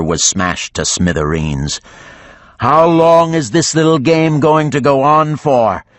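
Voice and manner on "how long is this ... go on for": higher, louder, hammed-up bored dragon; mock weariness. A narrator's reading. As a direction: sigh it like a put-upon giant. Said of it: "putting on a show of weariness".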